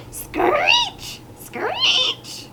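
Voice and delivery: very high, drawn-out screech; screeching owl. A narrator's reading. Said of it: "Al screeched".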